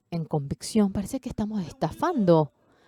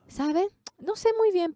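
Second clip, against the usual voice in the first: playful shrug; 13 semitones higher than usual. Retold or imitated. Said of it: "Yo le dije riéndome".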